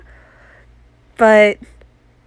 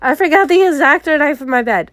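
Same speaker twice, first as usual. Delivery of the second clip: tearful mumbling; 6 semitones higher than usual. Retold or imitated. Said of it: "mumbling and slurring my words".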